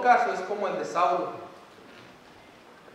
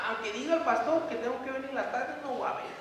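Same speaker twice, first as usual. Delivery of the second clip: higher mock whine of a stubborn believer; a pouty, higher mock of someone who won't come; mock stubborn churchgoer; 6 semitones higher than usual. Preacher mocking a churchgoer who skips service, higher whiny voice.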